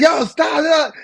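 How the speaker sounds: put-on high, loud kid yelling stop; mock protest. A kid teased by a sibling, high loud protest. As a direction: yell stop like a bugged kid. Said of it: "And then they'll be like".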